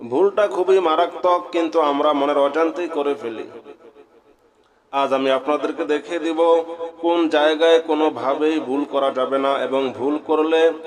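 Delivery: normal talking voice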